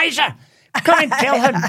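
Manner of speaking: high, loud call to another room; shouting for Isa to come through; retold shout. Great aunt calls her niece from the next room, shouting. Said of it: "And she shouted".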